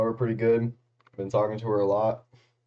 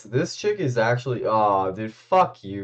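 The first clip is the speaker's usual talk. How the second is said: ordinary delivery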